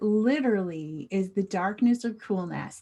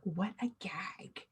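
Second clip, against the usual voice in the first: whispering; 12 dB quieter than usual. Acted. Example read in whisper.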